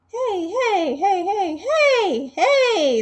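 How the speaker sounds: loud, high, laughing chorus of hey; crowd of birds yelling. Acted. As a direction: shout it like a whole flock jeering. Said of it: "they all say".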